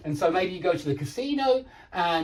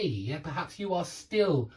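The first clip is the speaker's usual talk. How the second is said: normal talking voice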